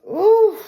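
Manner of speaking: high, loud wince of sympathy; sharp sympathetic cry; pained ooh. Real, not acted. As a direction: let out a gut-punched "ooh". Pained reaction to doubled grief.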